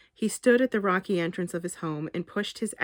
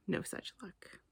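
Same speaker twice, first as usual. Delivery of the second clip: whispered aside; 2 semitones higher, 12 dB quieter than usual. A narrator's reading. Wry narrated aside.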